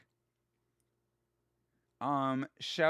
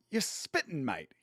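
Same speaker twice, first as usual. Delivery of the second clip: imitated English friend, thick accent; 2 semitones lower, 6 dB quieter than usual. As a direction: mimic a mate's strong English accent. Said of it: "very thick English accent".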